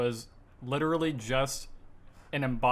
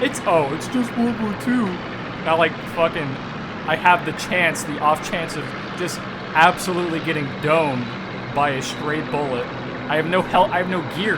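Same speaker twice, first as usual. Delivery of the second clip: exasperated shouting; 3 semitones higher, 7 dB louder than usual. Acted. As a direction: rant in disbelief over the gunfire. Mocks partner's dismissiveness under fire.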